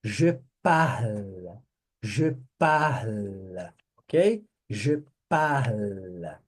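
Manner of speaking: Je parle said slowly, leaning on a clear final L; drawn-out Je parle, the L held; pron parle. Shown as a demonstration. Teacher stretching the L in parle for the class to copy. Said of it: "capricha nesse L".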